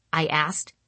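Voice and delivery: ordinary delivery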